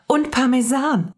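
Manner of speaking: put-on high, excited child; thrilled second discovery; delighted exclamation. A narrator's reading. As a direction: exclaim it with pitch way up. Mia discovers the cheese too.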